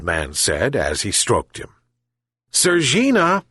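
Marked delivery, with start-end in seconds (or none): 2.5-3.4 shouting for the cat; shouted. A narrator's reading. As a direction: call the cat across the yard. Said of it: "shouted the old fisherman".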